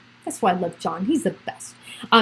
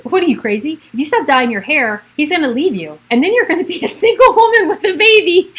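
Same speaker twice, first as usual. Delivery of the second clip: mock dramatic quote; 6 semitones higher, 5 dB louder than usual. Mock reading of pregnancy book.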